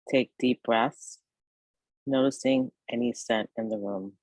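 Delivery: guided meditation. Acted. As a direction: ease the listener toward rest, phrase by unhurried phrase. Leads a yoga nidra meditation.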